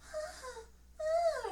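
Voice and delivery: high, whimpering dog whine; dog whining. Retold or imitated. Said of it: "Farley is all".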